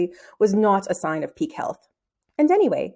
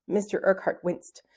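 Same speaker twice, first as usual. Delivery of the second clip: ordinary delivery, nothing marked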